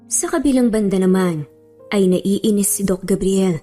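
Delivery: usual speaking voice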